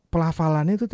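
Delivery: usual speaking voice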